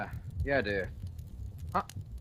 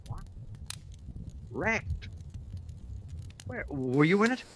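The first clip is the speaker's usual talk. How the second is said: alarmed questions. Acted. Wife reports car wreck.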